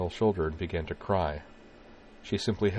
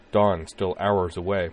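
usual speaking voice

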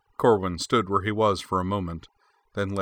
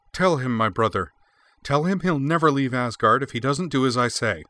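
louder, pleading appeal; entreating; 5 semitones higher, 5 dB louder than usual. A narrator's reading. Freya to her brother Frey, loud entreating plea. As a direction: beg your brother to back you. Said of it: "said in an entreating tone".

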